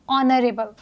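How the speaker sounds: "ऑनरेबल" starting on the vowel ऑ, no h; silent h. Shown as a demonstration. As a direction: say "ऑनरेबल" as on-rebul. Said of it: "इस word को हमने कैसे pronounce किया है?". ("ऑनरेबल" बिना ह के)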